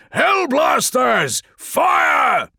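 very high, drawn-out yell; full-throated commander's shout; shouted order. Acted. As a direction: bellow the firing command to the squad. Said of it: "Felix ordered".

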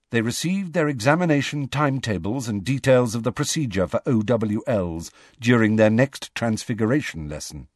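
ordinary delivery

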